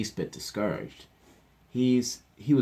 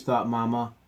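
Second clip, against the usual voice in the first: ordinary delivery, nothing marked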